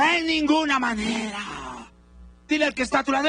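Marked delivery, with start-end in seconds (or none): 0.0-1.6 slow, loud congregational echo; repeat loudly. Shown as a demonstration. Preacher leads church in repeating, slow loud chant. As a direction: call it out slowly with the crowd. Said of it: "Repite bien fuerte conmigo".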